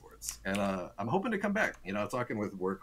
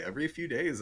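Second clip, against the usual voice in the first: ordinary talk, nothing marked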